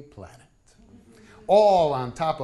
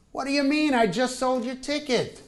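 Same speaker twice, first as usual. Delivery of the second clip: exasperated; 6 semitones higher than usual. Retold or imitated. Husband exasperated at wife.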